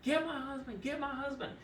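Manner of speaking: high pitched repeated mock yell for the husband; hammed-up patient calling for her husband. Woozy patient after egg collection, mock high yell for husband. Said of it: "she started yelling".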